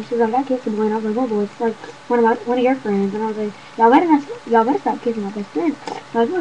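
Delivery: rapid-fire story, words tumbling out x1.5; talking fast. Shown as a demonstration. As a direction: rattle it off as fast as you can. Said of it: "Y'all wanna hear me talk fast?".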